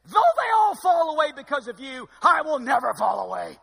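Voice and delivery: loud, high, shouted boast; Peter's boast. Acted. Preacher voicing Peter boasting to Jesus, shouting. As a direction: belt it out like he's unbreakable. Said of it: "Peter answers and says".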